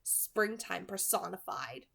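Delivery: soft, even audiobook reading; hushed novel narration, measured and soft; story narration. A narrator's reading. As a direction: read the chapter quietly to listeners. Reads a novel chapter aloud.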